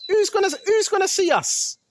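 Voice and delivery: high, mocking voice of the wicked. Preacher mocks the wicked who think nobody sees, high mocking voice. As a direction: sneer it high and scoffing. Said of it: "That's what they're saying".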